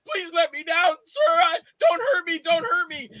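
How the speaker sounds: high, whimpering coward's plea; cowardly pleading. Acted. Guy lifted off the couch begs his attacker, cowardly pleading. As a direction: beg for mercy in a high frightened voice. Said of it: "You played such a pussy in that".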